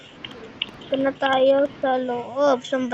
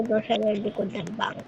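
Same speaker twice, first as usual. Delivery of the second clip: breathy whisper, sneaking in; 4 semitones lower, 8 dB quieter than usual. Real, not acted. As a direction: whisper so you're not caught. Sneaking into the bank.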